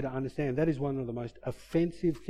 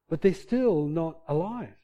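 ordinary talk

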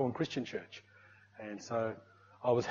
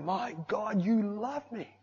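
retold awed shout; 7 semitones higher, slower than usual. Worshipper tells God of realised love, retold shout. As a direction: exclaim it high, as if reliving the shout. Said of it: "And I just shouted".

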